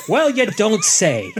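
high, slow, laughing sarcasm; mock amazement through laughter; sarcastic laughing. Sarcastic reaction to wiki.

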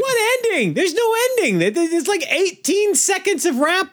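high-pitched indignant protest; incredulous objection. Real, not acted. Arguing with co-host about the ending, incredulous. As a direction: pitch it way up in disbelief. Heated objection to co-host's verdict.

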